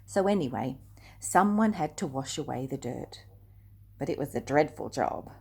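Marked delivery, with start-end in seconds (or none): none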